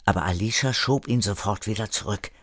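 ordinary delivery, nothing marked

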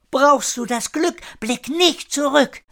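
put-on high-pitched girl's call. A narrator's reading. Gliding girl calls to Kire under the railing, high girlish call. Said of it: "rief das Mädchen".

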